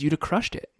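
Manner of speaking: ordinary delivery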